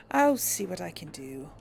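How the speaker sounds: usual speaking voice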